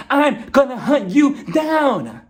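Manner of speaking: high, slow, punched-out mock threat; mock-furious shout, drawn out; hammed-up threatening shout. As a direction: shout it word by word, over-dramatic. Acting out Lisa's threat.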